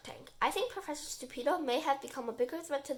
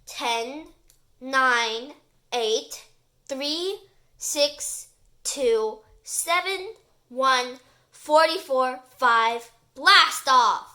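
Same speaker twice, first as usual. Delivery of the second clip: excited countdown; 11 dB louder, much slower than usual. Acted. Rocket launch countdown.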